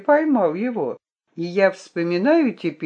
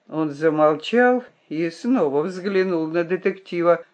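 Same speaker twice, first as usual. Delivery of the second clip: plain reading voice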